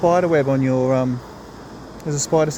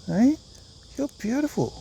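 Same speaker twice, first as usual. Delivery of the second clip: doting praise; 9 semitones higher than usual. Real, not acted. Praising pet bird.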